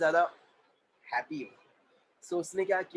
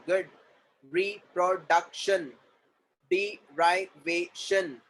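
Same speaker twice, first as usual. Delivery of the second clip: higher, word-by-word classroom dictation; syllable-by-syllable drill; 3 semitones higher, 4 dB louder, much slower than usual. Shown as a demonstration. Teacher breaking words into syllables for students, drilled evenly. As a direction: sound out each syllable for the class.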